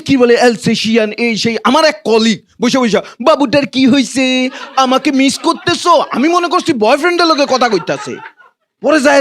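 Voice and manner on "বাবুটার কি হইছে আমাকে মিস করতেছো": put-on baby talk. Colleague baby-talks to dog.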